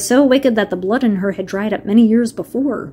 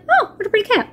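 put-on much higher, sweet little-girl voice; girlish Dorothy; 11 semitones higher, much faster than usual. Dorothy finding the golden cap, high and girlish. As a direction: coo over the pretty cap like a delighted child. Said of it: "she's like".

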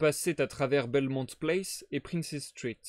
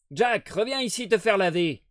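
crier fort, plus aigu, pour rappeler l'enfant; 6 semitones higher, 7 dB louder than usual. Acted. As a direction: appel lancé à pleine voix. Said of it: "cria-t-elle". (mère qui crie après son gamin)

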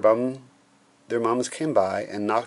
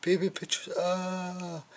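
put-on high wailing kid voice; storybook boy's pretend anguish; mock-horrified yell; 9 semitones higher, 3 dB quieter, slower than usual. A narrator's reading. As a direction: wail it high without volume. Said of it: "yelled Andrew".

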